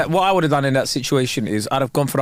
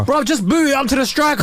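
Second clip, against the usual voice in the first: much higher, put-on fan voice; exasperated sports fan; 11 semitones higher than usual. Acting a fan instructing the players, exasperated. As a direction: plead with the team, high-pitched. Mimicking fans heckling players.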